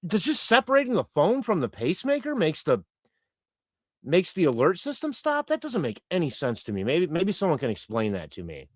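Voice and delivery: normal talking voice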